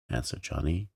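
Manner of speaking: ordinary delivery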